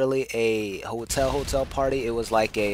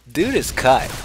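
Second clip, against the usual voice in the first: amused; 4 semitones higher, 5 dB louder, slower than usual. Real, not acted. Storyteller relaying the girl's verdict to her friends, amused. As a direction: chuckle just before the words, louder and higher.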